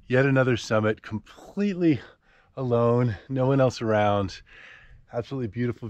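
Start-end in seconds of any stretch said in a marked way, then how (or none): none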